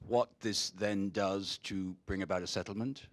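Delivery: ordinary delivery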